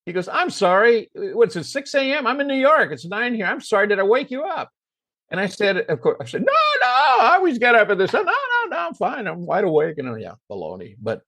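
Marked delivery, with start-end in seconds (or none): 0.3-4.7 high-pitched retold apology; acted flustered sorry; apologetic. Star apologizes for an early call. 6.4-10.0 very high, over-eager reassurance; hammed-up insistence, easing down at the end; eager denial. Hastily reassuring the star, falsely.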